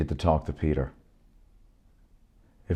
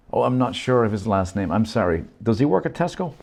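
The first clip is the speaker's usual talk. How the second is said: higher, louder, apologetic; Shri's voice; 6 semitones higher, 5 dB louder than usual. Acted. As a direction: ask hopefully, apologising. Shri asks.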